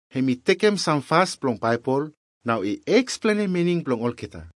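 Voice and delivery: ordinary delivery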